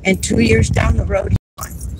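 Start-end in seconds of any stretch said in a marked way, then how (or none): 1.3-2.0 sad whisper. Real, not acted. Father's death.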